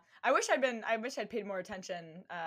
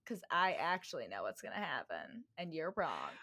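smug deadpan; 5 semitones lower, 6 dB quieter than usual. Retold or imitated. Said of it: "And I'm like".

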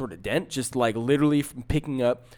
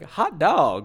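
higher, jaunty 1950s "hot dog"; old fifties exclamation; 4 semitones higher, 4 dB louder than usual. Retold or imitated. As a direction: exclaim it like an old-fashioned cartoon cheer. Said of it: "like, the old nineteen fifties".

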